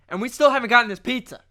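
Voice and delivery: mock outraged customer on the phone; exasperated complaint. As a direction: push the complaint out hard and quick.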